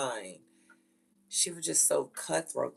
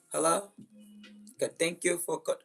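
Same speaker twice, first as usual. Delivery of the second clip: lightly mocked foreign caller's accent; mimicked Indian accent; 3 semitones higher than usual. Foreign caller answering the mother's phone, mimicked Indian accent. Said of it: "she hear that goddamn accent".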